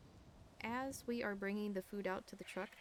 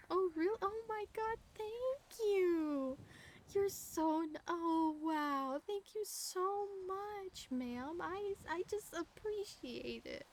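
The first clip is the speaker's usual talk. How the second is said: much higher, gushing thanks, slowing down; hammed-up gratitude; 10 semitones higher, much slower than usual. Retelling to a friend how she gushed thanks at the officer, high and hammed-up. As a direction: overdo the grateful relief to dodge a ticket. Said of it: "I did ham it up a bit".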